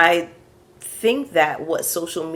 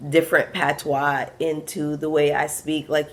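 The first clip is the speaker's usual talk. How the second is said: usual speaking voice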